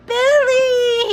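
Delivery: high, loud screamed name; shrill boy's yell across a platform; child's shout. A narrator's reading. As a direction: scream the name after a leaving train. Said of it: "he shouted".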